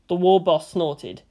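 ordinary delivery

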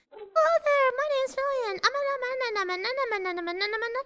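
put-on high cute anime girl voice; VTuber voice. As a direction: squeak it out like a VTuber. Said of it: "what I'm like".

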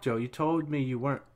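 usual speaking voice

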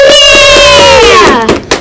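gasp, then high screamed cheer; thrilled, high-pitched shout; excited cheer. Acted. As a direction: burst into a long, loud cheer. Cheer at Jammer announcement.

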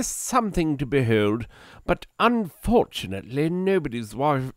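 put-on posh British accent. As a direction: recount it like a grand aristocrat. Back into posh reading accent.

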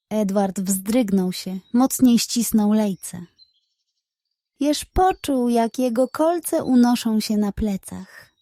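plain reading voice

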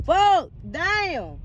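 usual speaking voice

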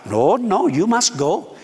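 insistent, put-on Indian accent; playful Indian-English imitation. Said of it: "I don't have to have an interpreter".

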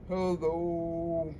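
deep, soft, low hello. Shown as a demonstration. Librarian leads the kids' low hello, deep and soft. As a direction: drop your voice down low. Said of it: "Let's say hello as low as we can".

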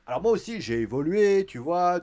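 mock Orton boast. Voicing Randy Orton loudly.

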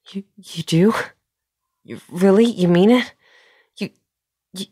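excited, incredulous questioning; delighted disbelief. Acted. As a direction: ask it as if you can't believe your luck. Learns the crush is returned.

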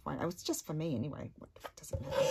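whispered aside. Real, not acted. Muttered aside while crafting.

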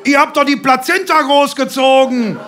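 high, loud jeering yell; shouted insult. A narrator's reading. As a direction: hurl the insult at your rival. Fathers trading shouted insults.

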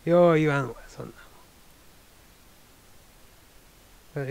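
punchy Kansai-dialect "よう言わんわ", loud and firm; 関西弁でぴしゃりと言い切る. Shown as a demonstration. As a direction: deliver it like a blunt Kansai local. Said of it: "関西弁で言うたら、".

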